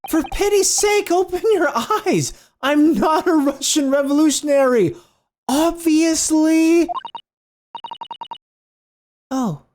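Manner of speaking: put-on an affronted young man arguing; indignant young lawyer. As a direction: push back hard at the accusation. Voices Ryunosuke's quarrel.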